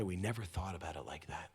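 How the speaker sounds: soft unvoiced whisper. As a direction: breathe it out as a whisper. Couple to rabbi.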